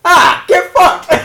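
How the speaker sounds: high, loud, drawn-out outburst; exasperated shout. Real, not acted. Co-host caught out in a word argument, exasperated shout. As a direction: yell it in comic outrage. Loses mock pronunciation argument.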